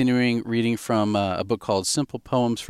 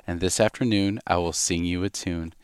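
put-on reading a poem to a baby; 2 semitones lower than usual. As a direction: read it gently to the baby.

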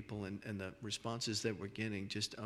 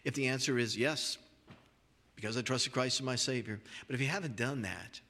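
louder, earnest altar call voice; preaching at full force; 5 dB louder than usual. Real, not acted. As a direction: press the call hard and loud to the listeners.

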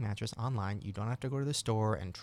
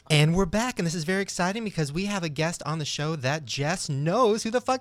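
much louder, higher excited host return; hyped show intro; 8 semitones higher, 8 dB louder than usual. Real, not acted. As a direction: announce the return with big energy.

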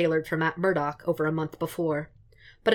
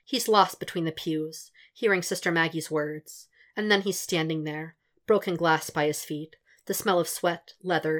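usual speaking voice

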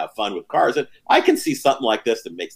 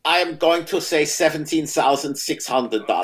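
put-on German accent; 2 semitones higher, 4 dB quieter than usual. Guest placing his bid in a clipped-on German accent. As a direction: announce the price like a stiff German. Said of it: "he's been to the homeland".

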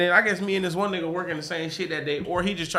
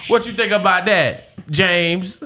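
entitled customer; 4 dB louder than usual. Pretend. Acting a customer's demands, laughing.

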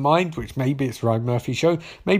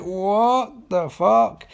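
drawn-out, higher-pitched disbelief; baffled disbelief; 5 semitones higher, much slower than usual. Retold or imitated. Recapper reacting to three years later, baffled. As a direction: stretch every word in bewilderment.

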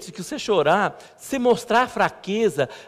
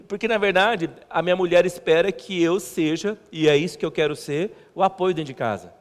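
normal talking voice

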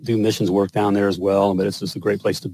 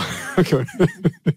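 a burst of real laughter, higher; 7 semitones higher than usual. Real, not acted. Husband laughing at his own libido quip, real laughter.

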